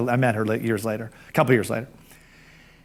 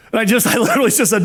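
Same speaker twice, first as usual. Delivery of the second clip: laughing; 5 semitones higher, faster than usual. Real, not acted. Laughs while retelling.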